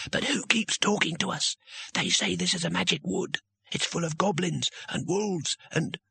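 high, hushed, uneasy voice; nervous junior wizard. A narrator's reading. Junior wizard fearing the magic wood, nervous. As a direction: fret in a high, small voice.

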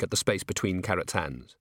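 plain reading voice

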